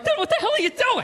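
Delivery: shocked, half-laughing, pleading; alarmed disbelief. Retold or imitated. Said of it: "I'm looking over my shoulder like".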